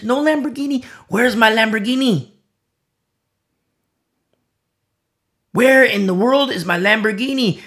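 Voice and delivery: high-pitched, exasperated complaining; mounting impatience. Acted. Believer demanding the promised Lamborghini from God, mock exasperation. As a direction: act out waiting that builds into an indignant demand. Acts out believer tired of waiting.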